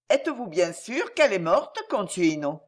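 usual speaking voice

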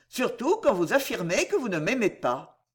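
ordinary delivery

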